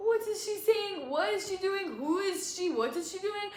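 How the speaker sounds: high, sneering mock of the girls; mocking mean girls. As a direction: imitate them talking down at her.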